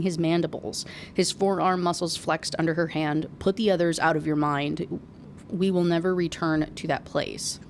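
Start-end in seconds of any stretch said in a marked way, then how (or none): none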